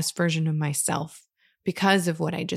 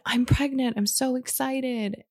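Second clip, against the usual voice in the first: a lightly voiced giddy friend, higher; mild imitation of an excited friend; 7 semitones higher than usual. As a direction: mimic the friend's happy news, pitched up. Friend's text voiced in retelling.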